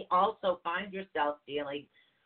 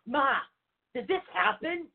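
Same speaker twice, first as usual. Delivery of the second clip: high, bright imitation of herself asking Mom; mock eager question; 10 semitones higher, 4 dB louder than usual. Calling her mother, she acts out an eager high question. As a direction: play it like an excited kid checking with Mom.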